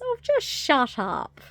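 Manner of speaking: high, drawn-out mock exasperation; lightly acted fed-up sidekick; exasperated goblin helper. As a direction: wearily shush a doomsayer, hamming it up a little. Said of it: "the guy who's helping her goes".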